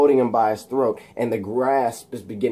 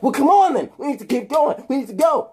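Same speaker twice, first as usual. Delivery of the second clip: urgent pushing; 12 semitones higher than usual. Acted. Eddie Brock hurrying the pilot, high and insistent. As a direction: push him hard to get moving.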